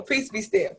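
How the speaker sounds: soft calming repeat. Shown as a demonstration.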